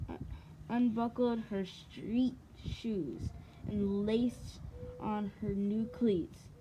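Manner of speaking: usual speaking voice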